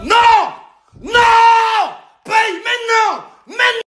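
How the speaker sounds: high-pitched shouted refusal; worked-up anger. Acted. Gambler refusing to come back later, furious shout. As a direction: yell it, demanding payment on the spot. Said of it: "pourquoi t'es énervé".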